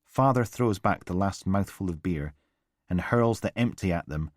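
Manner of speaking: ordinary delivery, nothing marked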